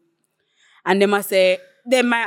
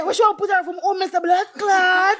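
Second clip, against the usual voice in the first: high-pitched retold outburst on the edge of laughter; imitating indignant man; 10 semitones higher than usual. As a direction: mimic his outrage in a high voice. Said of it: "The man said".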